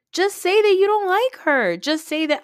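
loud, high-pitched insistence; exasperated pleading. Acted. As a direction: urge it loudly, pitch shooting up. Exasperated plea to Meredith.

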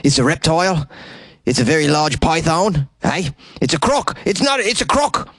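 over-the-top Australian voice; Australian accent. Pretend. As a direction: go full croc-hunter Aussie. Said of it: "fun words to say with an Australian accent".

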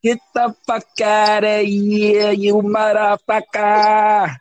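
slow, high celebratory holler; excited. Real, not acted. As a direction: whoop it out at midnight. Midnight New Year celebration.

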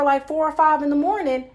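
ordinary delivery

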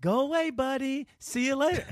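high, cooing mock voice for a dog; mock sweet coaxing. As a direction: sing it up high, teasing his gentleness. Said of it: "go from".

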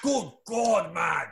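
high, drawn-out English cry for order; blustering English accent. Shown as a demonstration. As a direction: bellow like an outraged English judge. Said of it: "Then there's order".

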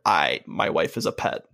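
deep, flat villain quote; lightly voiced Omni-Man, uncaring; low cold villain voice. Retelling Omni-Man's cold line.

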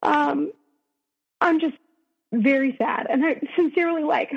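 upset, voice rising as the sadness returns; real sadness in voice. Real, not acted. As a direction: hold back tears while telling it. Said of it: "you could hear it in my voice".

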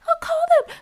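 hammed-up, high-pitched obedience; put-on sweet compliance. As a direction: squeak it out as an exaggerated willing reply. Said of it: "I didn't say it in, like, a".

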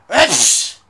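very loud mock sneeze; imitated sneeze. Retelling a man at Walmart, loud imitated sneeze. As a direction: fake an explosive, uncovered sneeze. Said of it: "coughing and fucking sneezing".

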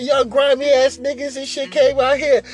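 angry woman on the phone. Retold or imitated. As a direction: pitch it up and scold. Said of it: "Phone going off".

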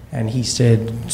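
ordinary talk

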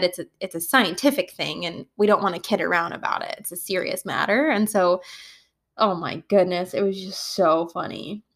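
ordinary talk

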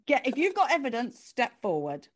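loud playful summons to an inner critic; boisterous courtroom-style demand; mock challenge. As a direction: call the accused forward, half amused.

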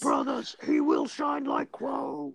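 very high, slow, laughing shout; high slow cackling war cry; Mad Max war boy. Acted. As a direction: scream it like a crazed war boy.

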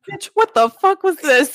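high, loud mocking voice; rude commenter voice. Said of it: "She'll just comment and be like".

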